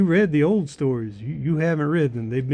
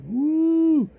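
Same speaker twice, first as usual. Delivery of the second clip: high excited woo; excited whoop; 13 semitones higher, 3 dB louder than usual. Real, not acted. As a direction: cheer about the cover. Excited about the cover.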